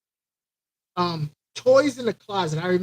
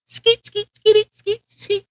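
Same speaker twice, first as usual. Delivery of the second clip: scratch noise; 11 semitones higher, slower than usual. Retold or imitated. Imitating records being scratched.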